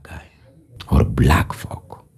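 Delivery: whisper. Real, not acted. Low aside after a loud claim.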